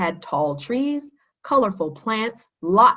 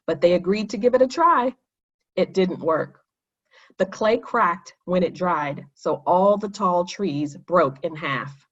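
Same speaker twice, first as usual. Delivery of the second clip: plain reading voice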